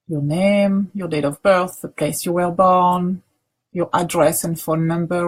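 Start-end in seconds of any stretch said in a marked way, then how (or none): none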